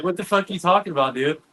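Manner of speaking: high, quick incredulous question; retold bewilderment. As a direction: fire off a mock-baffled reaction. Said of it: "I'm like".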